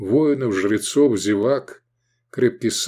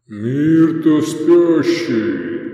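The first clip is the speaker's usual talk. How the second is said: very slow, loud, rolling call; drawn-out demonic roar; demonic boom; 5 semitones higher, 6 dB louder, much slower than usual. A narrator's reading. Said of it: "откликнулся бас, демоническим рокотом".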